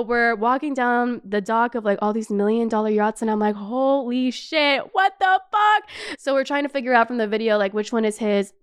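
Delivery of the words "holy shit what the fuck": retold awe. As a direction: stretch it out, stunned by the sight. Acted awe at million dollar yachts.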